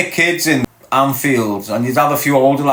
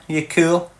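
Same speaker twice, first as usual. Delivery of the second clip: normal talking voice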